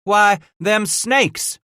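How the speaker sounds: high, loud, drawn-out realization; surprised recognition. Acted. Trapper recognizing the riders, loudly surprised. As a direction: stretch it out in rising astonishment.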